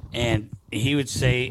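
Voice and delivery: ordinary delivery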